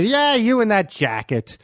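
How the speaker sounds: high, stretched dismissive jab; imitated teasing put-down; taunting retort. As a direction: drag it out to needle him. Norm's taunting reply, acted out.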